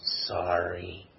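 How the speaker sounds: a very soft, low, mumbled "Sorry"; the child still not meaning it, barely audible; sulky sorry. Retold or imitated. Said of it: "say it like you mean it".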